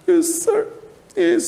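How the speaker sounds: very high, mild tearful yes sir; a child's small sobbing reply; weeping. Acted. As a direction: Oliver answering the board through tears. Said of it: "he started weeping bitterly".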